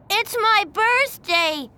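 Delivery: put-on high, loud, slow kid's tantrum; angry child. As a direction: stamp it out like a spoiled boy. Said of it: "he said angrily".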